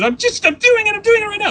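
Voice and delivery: put-on high, loud whiny kid voice; daughter's whiny protest. Daughter protesting to dad, high and whiny. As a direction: squeal it like an exasperated child.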